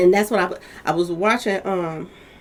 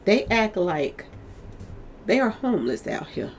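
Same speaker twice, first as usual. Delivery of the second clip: normal talking voice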